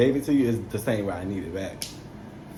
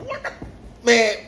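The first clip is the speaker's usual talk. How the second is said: startled exclamation; 10 semitones higher than usual. Retold or imitated. Shocked she picked up.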